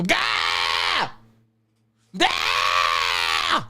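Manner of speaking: mandated scream. Pretend. Townsfolk obeying the screaming edict, low shouted Gah. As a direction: bellow it on the front lawn. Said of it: "you gotta scream".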